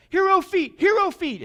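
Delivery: high, loud call for the ball; girl calling out. Retold or imitated. Girl on far side calling to the defender, high loud shout. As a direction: shout it to your teammate. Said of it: "she's like".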